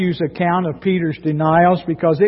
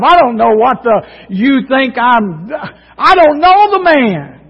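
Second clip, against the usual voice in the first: much higher and louder, near tears, cursing; cursing denial; 6 semitones higher, 4 dB louder than usual. Retold or imitated. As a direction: cry out the denial, breaking into a curse. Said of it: "to cussing about it, calling down curses on himself".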